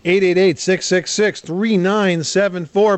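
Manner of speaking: slow, emphatic number read; phone number read. Acted. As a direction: spell the number out for listeners.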